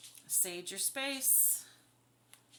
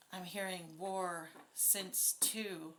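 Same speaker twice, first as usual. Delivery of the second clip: ordinary talk, nothing marked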